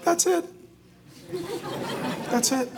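high-pitched mock exasperation; parent at breaking point. Exhausted parent reaching the limit, exasperated. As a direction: squeeze it out high, as if fed up. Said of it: "in a moment of exhaustion, you're like".

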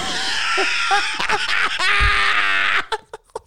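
high shrieking laughter; real laughter bursting out; howling laugh. Real, not acted. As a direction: crack up completely, high and loud. Laughing hard at own story.

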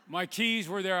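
laughing while repeating the punchline. Real, not acted. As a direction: laugh through the words in disbelief. Laughing retelling the keys.

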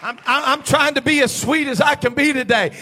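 loud, high fiery preaching, near shouting; full-force preaching. Acted. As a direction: preach it to the back row with fire.

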